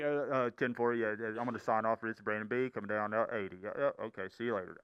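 low, quiet CB-radio chatter; hushed, deep two-way radio voice; radio trucker sign-off. Acted. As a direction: act a trucker signing off on the radio. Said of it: "it's not like you're on the break".

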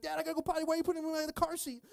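put-on high, urgent little-kid voice; imitated whiny child; two-year-old son. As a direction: protest like a panicked toddler. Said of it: "He's like".

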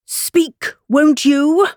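high, loud demanding cry. A narrator's reading. King demanding that Hatter speak, crying out. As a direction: call out insistently, pitched well up. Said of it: "cried the king".

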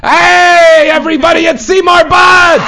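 loud, high announcer welcome; excited shout. Real, not acted. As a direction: shout his name to the room. Host announces a surprise caller.

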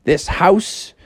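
jubilant talking house. Acted. House awards Froggy the prize, high and jubilant. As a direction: exclaim grandly, pitched well up. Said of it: "The guy was like".

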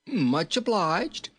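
high, slow, merry thanks; jolly little king. A narrator's reading. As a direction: stretch it out in a high character voice.

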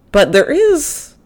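playful suspense before the reveal; high dramatic tease. Acted. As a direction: stretch "But there is," like a teaser. Dramatic build to the shower reveal.

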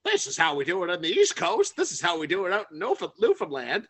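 hammed-up proud diner; boastful East Coaster. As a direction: show off like a proud Newfoundlander.

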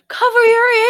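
high, loud howl of warning; hammed-up high dog voice; voicing the dog. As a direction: wail it like an alarmed dog. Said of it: "your dog was like".

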